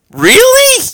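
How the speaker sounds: very high, loud disbelieving cry; shocked disbelief. Retold or imitated. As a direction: shriek it in disbelief. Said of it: "that kinda act".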